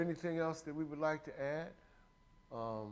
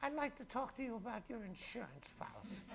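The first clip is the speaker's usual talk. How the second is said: insurance telemarketer; 13 semitones higher than usual. Retold or imitated. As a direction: mimic a fussy insurance caller, pitched way up. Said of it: "when I get a call and goes".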